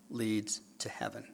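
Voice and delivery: soft whisper; whispered point. Acted. Preacher to congregation, whispering the point. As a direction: let it land in a hush. Hushed punchline.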